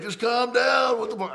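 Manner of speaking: high-pitched retold yell of alarm; exasperated shout. Followers yelling at defendant who is losing it, exasperated. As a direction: call out urgently, imitating the crowd. Followers urge him to calm down.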